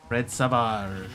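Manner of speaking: slow Savage, said "sa-VAHZH"; mock-French Savage with a long final vowel; French-style pronunciation. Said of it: "as Fred Savage".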